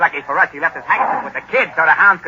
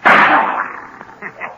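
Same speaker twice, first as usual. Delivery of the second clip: laughter; 12 semitones higher than usual. Acted. Gunman laughs at missed shot.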